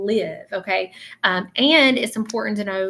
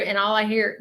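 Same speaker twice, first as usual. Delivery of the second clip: ordinary talk, nothing marked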